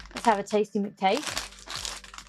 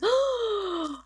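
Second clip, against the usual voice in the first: excited gasp; 11 semitones higher than usual. Real, not acted. I've just seen something very exciting.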